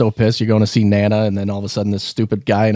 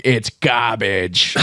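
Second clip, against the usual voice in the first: slow gruff dismissal; much slower than usual. Retold or imitated. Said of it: "uncle at a cookout was like".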